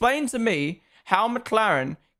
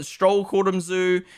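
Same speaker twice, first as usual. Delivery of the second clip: ordinary talk, nothing marked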